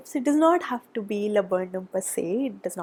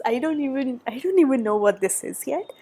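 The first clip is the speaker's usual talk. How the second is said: normal talking voice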